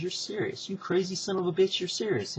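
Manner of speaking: retold amazed realisation, voice lifted high; incredulous. Bischoff reacting to Heyman's scheme, incredulous.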